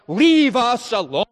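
high, loud shout to be left alone; shouted demand. Acted. Israelites shouting at Moses to be let alone. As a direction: fling it out, fed up.

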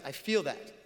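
ordinary talk, nothing marked

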